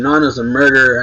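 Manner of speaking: loud, fast accusing yell; retold yell. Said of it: "bursts in yelling".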